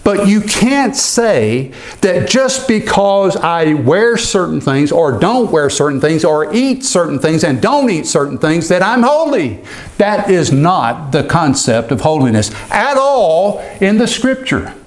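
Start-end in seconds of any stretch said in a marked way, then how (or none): none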